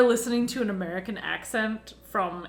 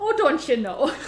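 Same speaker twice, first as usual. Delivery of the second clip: higher, lilting Midwestern catchphrase; put-on Midwest accent; 4 semitones higher than usual. Friend joins mocking Midwesterners, playful Midwest accent. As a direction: play up a Midwest accent lightly. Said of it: "try to do the Midwest accent".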